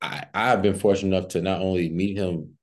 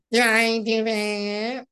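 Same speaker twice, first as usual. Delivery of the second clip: very high, squeezed nerd squeal; slow, high-pitched catchphrase in a put-on voice; Urkel impression; much slower than usual. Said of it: "can you do your Urkel impression".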